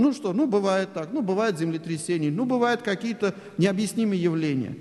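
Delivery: normal talking voice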